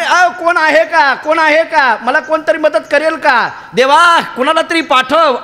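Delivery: high, drawn-out mock call for help; imitated crying out. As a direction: call out to God as the stranded saint, stretching the words. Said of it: "असं म्हणून ओरडले, बोंबलले".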